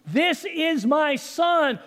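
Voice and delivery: mildly booming divine declaration; booming God. Acted. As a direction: announce it from heaven. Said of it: "God's voice booms".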